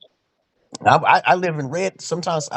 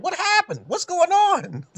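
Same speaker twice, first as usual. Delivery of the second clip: high, bewildered plea with hands up; startled high voice; slower than usual. Retold or imitated. Man with hands up asking police what's going on, high and startled. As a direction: pitch it way up, playing innocent.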